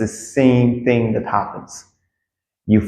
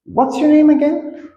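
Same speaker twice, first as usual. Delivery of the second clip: high-pitched, sheepish question; polite re-asking; slower than usual. Shown as a demonstration. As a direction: ask high and politely, as if you forgot. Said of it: "you say it like this".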